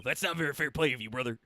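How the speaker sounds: loud wrestler promo growl, brother; Hulk Hogan. Retold or imitated. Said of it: "Hulk Hogan".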